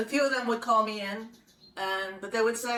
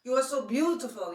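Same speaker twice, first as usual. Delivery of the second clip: put-on higher, slow Italian-accented flattery; 5 semitones higher than usual. Photographer gushing to young model in Italian accent. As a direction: gush the compliment in an old-country Italian accent. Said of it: "he had this Italian accent".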